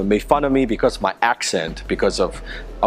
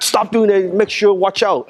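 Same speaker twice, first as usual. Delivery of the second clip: raised, higher voice of a teacher warning; retold warning shout; 7 semitones higher than usual. Teacher warns the bully to stop, in a raised, higher mock shout. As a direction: bark a retold warning, pitched high.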